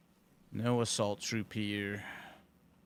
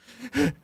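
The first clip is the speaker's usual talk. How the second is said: mock gasp; 6 dB louder than usual. Shown as a demonstration.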